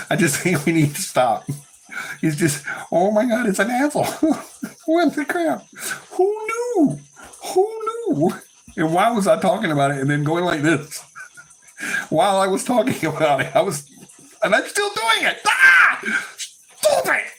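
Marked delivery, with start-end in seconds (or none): 2.9-8.3 very high, giddy outburst; hysterical squeal. Real, not acted. Helpless laughing fit over a joke. 14.4-17.3 very high, squealed outburst; real laughing shriek. Real, not acted. Shrieks at own gesture while laughing.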